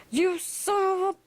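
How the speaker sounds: high-pitched acted outburst of rage; furious, high-pitched storyteller's outburst; snapped out crazy. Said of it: "I totally snapped out crazy".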